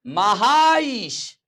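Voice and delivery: "महाईश" with long आ gliding into long ई; drawn-out pronunciation. Shown as a demonstration. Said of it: "क्या कहोगे?".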